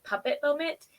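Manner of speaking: ordinary talk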